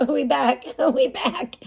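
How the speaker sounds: giggling we back between laughs; laughing. Real, not acted. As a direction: laugh it out with relief. Laughing with relief.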